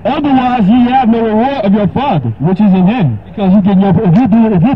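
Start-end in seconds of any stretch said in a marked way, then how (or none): 0.1-3.2 raised, solemn King James reading; scripture reading. Acted. Preacher reading Matthew six to a caller, solemn. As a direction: read it like holy writ.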